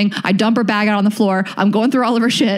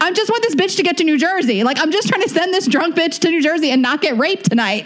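much higher-pitched, worked-up exasperation; retold exasperated plea; 10 semitones higher than usual. Woman begging cab drivers to take a drunk stranger home, exasperated. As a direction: plead in disbelief, pitched way up.

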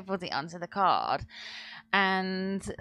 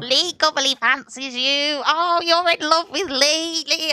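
high, slow sing-song teasing; higher, slower mocking schoolyard chant; mocking sibling tease; 7 semitones higher, 6 dB louder than usual. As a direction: taunt her like a bratty big brother. Teasing brother voiced in story.

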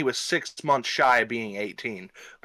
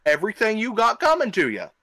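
indignant scolding; 7 semitones higher than usual. Pretend. Scolding the absent attacker directly.

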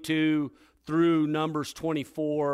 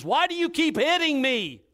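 donkey complaining; 11 semitones higher, 5 dB louder than usual. Acted. Talking donkey complains to Balaam.

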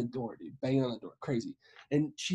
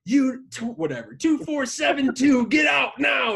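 shouted house number and order; mock police shout; imitated screaming; 9 semitones higher, 5 dB louder than usual. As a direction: yell it high and loud like police outside. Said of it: "screaming her house number".

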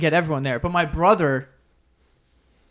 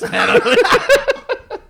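elf voice; 6 dB louder, much slower than usual. Pretend. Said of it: "voices like this".

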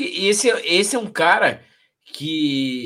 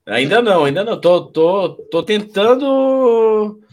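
slurred speech; 2 semitones higher, 5 dB louder than usual. Real, not acted. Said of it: "o Éder tá falando que eu já tô falando arrastado".

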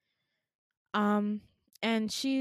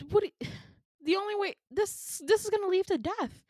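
high, stumbling protest; acted alarm; 10 semitones higher than usual. Warning Jessica off the jump, alarmed. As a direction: stammer it out up high.

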